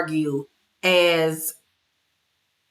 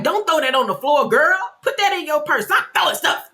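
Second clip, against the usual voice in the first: higher, scolding a friend in disgust; 8 semitones higher than usual. Retold or imitated. Mary scolding Brittany for throwing cards, higher disgusted scolding. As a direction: chide her like a disgusted auntie. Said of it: "Mary was so disgusted".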